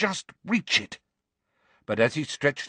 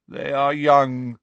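slow and mournful; slow, doleful voice; 2 semitones higher, 5 dB louder, slower than usual. Acted. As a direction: draw it out dolefully. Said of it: "said a slow, doleful voice".

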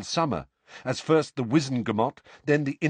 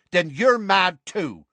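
loud, slow, higher-pitched dismissal; disgusted; 9 semitones higher, 5 dB louder, slower than usual. A narrator's reading. Seamus gives up on Ron, loudly disgusted. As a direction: write him off with disgust. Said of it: "said Seamus in disgust".

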